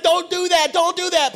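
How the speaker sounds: high, loud panicked pleading; scared driver. Retold or imitated. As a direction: imitate a frightened driver begging urgently. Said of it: "extremely scared".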